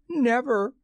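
put-on high, loud girlish outburst; high-pitched, forceful exclamation; defiant cry. A narrator's reading. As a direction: cry out in sharp refusal. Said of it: "she cried".

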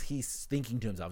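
usual speaking voice

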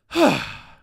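loud, high exhale of relief; big sigh of relief. Retold or imitated. Sitting down next to the kid, a loud relieved sigh. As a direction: let out one huge relieved sigh. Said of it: "like that".

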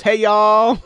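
high, loud group hail; imitated employee greeting. Employee answering the joking strangers, high and loud. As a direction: holler it back in a put-on high voice. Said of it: "And she goes".

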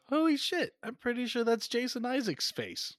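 mock astonishment in a high voice; acted amazed realization. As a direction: act a sudden high-pitched realization. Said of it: "this is when you are like".

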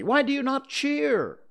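high, loud indignant question; heated, insistent outcry; hotly. A narrator's reading. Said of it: "he said hotly".

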